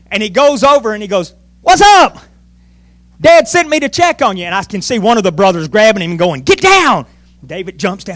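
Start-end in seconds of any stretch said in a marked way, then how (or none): 1.6-4.4 high, loud burst of hello; eager greeting. Acted. Acting David greeting his brothers. 6.4-7.1 high-pitched urgent yell; urgent command. Acted. Acting a brother grabbing David.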